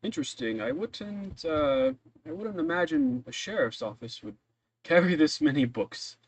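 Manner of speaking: put-on a kindly old man, bemused then polite; old librarian voice. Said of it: "he goes".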